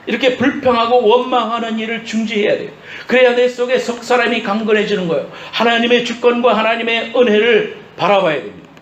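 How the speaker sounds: high, forceful exhortation; impassioned preaching. Real, not acted. Preacher telling congregation to stop complaining, impassioned preaching. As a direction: press the point urgently on the congregation. Preaches with raised, urgent delivery.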